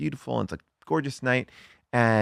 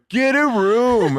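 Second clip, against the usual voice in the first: much higher, louder, slow sing-song taunt; mocking kid; 12 semitones higher, 5 dB louder, much slower than usual. Imitating the twelve-year-old heckling the kissing couple, high slow taunt. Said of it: "kid sitting on a bluff going".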